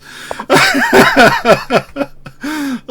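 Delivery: loud, high falling laugh; high laughing burst. Real, not acted. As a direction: crack up again. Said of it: "I'm laughing".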